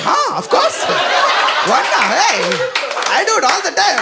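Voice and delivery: high, cocky scoffing voice; hammed-up know-it-all brag; mock smug guy. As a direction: play the smug guy who does it all the time.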